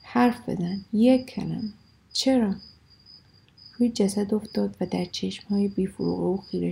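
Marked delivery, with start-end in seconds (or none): none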